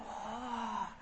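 very soft, breath-held oh; hushed oh of wonder, acted; imitated awed gasp. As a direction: softly mimic her amazement. Wife's awed reaction to the message.

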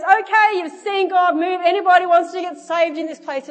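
loud, high acted summons; altar-call shout. Kid inviting shoppers to get saved, loud altar call. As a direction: call out to the whole crowd.

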